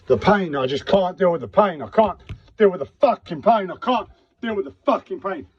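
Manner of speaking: high-pitched pained outburst; overwhelmed, voice rising in distress; real desperation. Real, not acted. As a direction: plead out in unbearable pain. Desperate outburst about pain.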